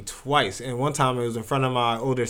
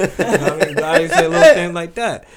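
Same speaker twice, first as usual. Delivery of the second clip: laughing; 3 semitones higher, 7 dB louder than usual. Real, not acted. Laughing at his old jokes.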